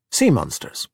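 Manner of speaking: understated, falling suggestion; tentative hint. A narrator's reading. Alberto tests Giulia with his secret, tentative. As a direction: float it as a casual what-if. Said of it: "I don't know".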